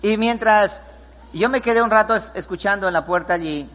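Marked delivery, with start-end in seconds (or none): none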